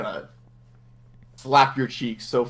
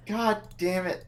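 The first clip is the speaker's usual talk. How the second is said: higher grumble; 7 semitones higher, 6 dB quieter than usual. Real, not acted.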